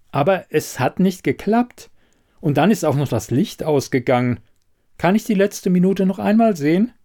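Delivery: a hint of a knowing investigator; lightly voiced shrewd investigator. Elida probing the quizmaster about the candidate, lightly voiced shrewdness.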